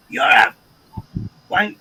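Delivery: punched-out insult, cut short; intense swearing. Shown as a demonstration. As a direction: hit the swear word hard, as if meant. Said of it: "But then I can go".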